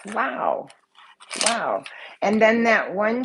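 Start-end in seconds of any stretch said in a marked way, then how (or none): none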